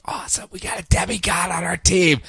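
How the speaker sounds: excited whisper; whispered cheer. Retold or imitated. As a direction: hush it with glee. Titans cheer, voiced whisper.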